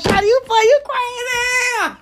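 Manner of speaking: high, drawn-out mock cackling cry; imitated laughing outburst. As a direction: squeal it like onlookers cracking up. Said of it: "bust out laughing like".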